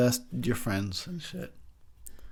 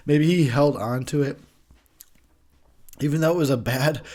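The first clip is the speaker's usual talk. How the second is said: ordinary talk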